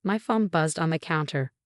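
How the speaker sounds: ordinary delivery, nothing marked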